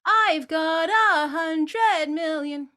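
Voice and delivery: sung slow and loud, pushed out; wrong loud-quiet take. Shown as a demonstration. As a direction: chuck the sound out. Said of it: "it'll sound more like this".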